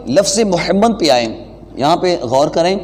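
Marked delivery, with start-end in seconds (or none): none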